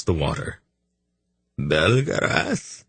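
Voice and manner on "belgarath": put-on slow, wavering elderly call; a hint of a frail old man calling out; quavering old man. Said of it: "he called in a quavering voice".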